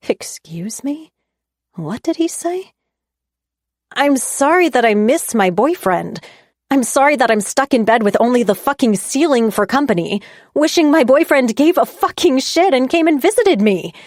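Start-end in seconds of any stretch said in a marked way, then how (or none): 3.9-13.9 louder and higher, building to a yell; losing it. A narrator's reading. Stella yelling at Dan, losing it. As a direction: let it all burst out. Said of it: "I've never lost it like that before".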